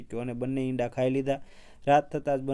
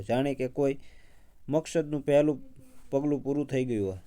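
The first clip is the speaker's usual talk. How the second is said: plain reading voice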